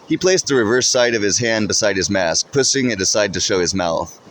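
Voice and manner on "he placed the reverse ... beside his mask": ordinary delivery, nothing marked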